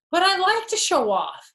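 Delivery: put-on high, loud childish complaint; whiny protest. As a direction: protest like a cheeky kid. Fox protesting.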